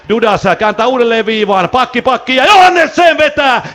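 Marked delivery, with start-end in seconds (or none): 1.7-3.7 higher, slower call building to the shot; excited call. Real, not acted. Commentator calling the point shot, higher excited call. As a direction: lift the voice as the shot comes.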